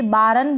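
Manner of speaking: "बारन" में र पूरा, बा-रन; गलत मिसाल "बारन", दो हिस्सों में; पूरा र, गलत रूप. Shown as a demonstration. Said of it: "बोलेंगे तो गलत हो जाएगा". ("बारन" में र के बाद छोटा अ)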